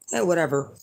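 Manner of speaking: usual speaking voice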